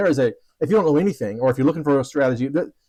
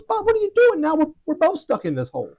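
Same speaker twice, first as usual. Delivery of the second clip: much higher, startled cry of disbelief; shocked disbelief; 14 semitones higher than usual. Retold or imitated. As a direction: react as if your friend just did something crazy. Shocked friend jumps into hole.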